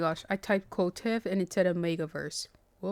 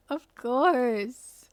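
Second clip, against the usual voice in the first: swooning fangirl; 4 semitones higher, 4 dB louder than usual. Pretend. Acting swooning reader reply.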